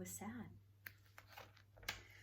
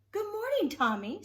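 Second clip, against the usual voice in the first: much higher and louder, bright sing-song hello; cheery greeting; 14 semitones higher, 10 dB louder than usual. Acted. Betty greeting her old friend Tom Turtle, cheerful high greeting. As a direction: call out a delighted good morning to a friend.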